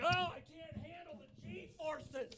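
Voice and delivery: helpless, pitiful put-on voice; mock feeble whine. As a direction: whine like a fragile infant. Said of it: "Just like".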